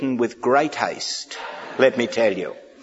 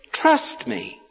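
whispered; 12 semitones higher than usual. Shown as a demonstration. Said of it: "He wants us to trust him".

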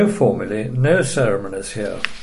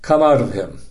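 restrained, firm scriptural command; mildly stern. A narrator's reading. Jesus orders the spirit out, mildly stern. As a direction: read with a touch of sternness. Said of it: "said Jesus sternly".

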